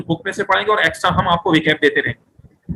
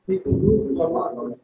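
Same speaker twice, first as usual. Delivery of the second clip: quiet model repeat; 6 dB quieter than usual. Shown as a demonstration. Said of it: "بولیے".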